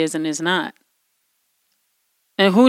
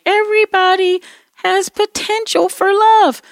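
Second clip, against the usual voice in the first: high mock voice; 12 semitones higher, 3 dB louder than usual.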